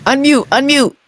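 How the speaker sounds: high-pitched prompt to unmute; calling out. Real, not acted. Host prompting a muted guest to unmute, calling out.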